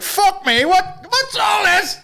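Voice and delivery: high, drawn-out mock astonishment; imagined swearing pilot. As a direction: put on a high, gobsmacked voice. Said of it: "if the guy was like".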